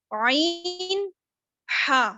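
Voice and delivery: आइन stretched very slow from the centre of the throat; teacher stretching आइन from the throat; drawn-out ain. Shown as a demonstration. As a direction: pull आइन out long and slow with effort. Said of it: "आप इसको आइन नहीं पढ़ेंगे".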